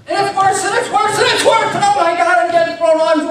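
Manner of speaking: building panic. Retold or imitated. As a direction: climb the pitch with each worse.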